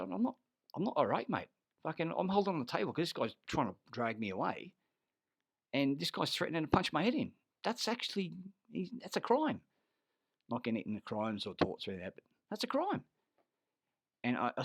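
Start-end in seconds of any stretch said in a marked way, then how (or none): 0.0-9.6 heated protest. Retold or imitated. Retold heated argument.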